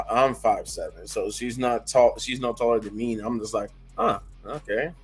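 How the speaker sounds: normal talking voice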